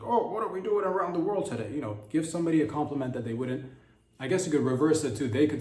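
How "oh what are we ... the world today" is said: higher pompous mock-official voice; hammed-up stuffy diplomat; mock serious statesman. President mocking official seriousness, higher and pompous. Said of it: "just be like".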